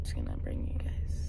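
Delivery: hushed whisper. Real, not acted. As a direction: whisper so she won't wake. Whisper not to wake friend.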